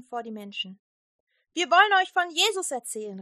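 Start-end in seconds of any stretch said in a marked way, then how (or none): 1.5-3.2 loud, high call to the crowd. A narrator's reading. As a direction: call it out to the people. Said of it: "rief er".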